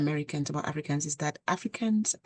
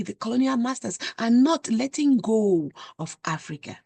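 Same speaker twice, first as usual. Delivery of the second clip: higher, louder, rising passion; impassioned; 6 semitones higher, 3 dB louder than usual. Real, not acted. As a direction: plead with growing fervour. Passionate appeal about colonization.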